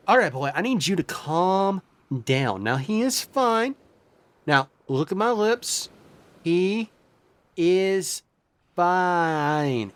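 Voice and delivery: each word spaced, mouthed out; slow, word by word. Acted. Healer calming the panicking lizard man, word by word. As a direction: spell out he is fine with pauses. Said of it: "he's like".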